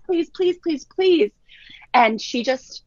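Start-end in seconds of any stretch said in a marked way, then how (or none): 0.0-1.3 high pleading please; begging kids. Retold or imitated. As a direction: plead like a crying child. Kids crying and begging mom.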